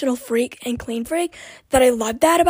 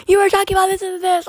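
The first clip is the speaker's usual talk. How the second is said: high fast imitation of a viewer; mock listener; 7 semitones higher, 4 dB louder, faster than usual. Imitating viewers reminding her, high and quick. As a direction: toss it off like a know-it-all viewer. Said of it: "you're probably like".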